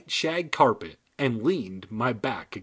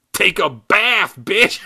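much louder, higher, slow insult; shouted punchline; 8 semitones higher, 9 dB louder, slower than usual. Acted. Dummy yelling the punchline at Brittany, loud. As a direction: yell the insult with glee.

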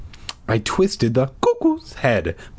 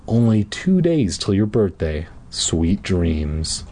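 tender goodnight; 6 semitones lower, slower than usual. Acted. Mom kisses son goodnight.

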